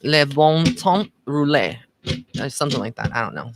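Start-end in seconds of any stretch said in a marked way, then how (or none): 0.0-1.8 "le bon temps roulé" said slowly with a French-sounding nasal "bon"; a hesitant French attempt. Shown as a demonstration. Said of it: "when you read it, like, certain words are".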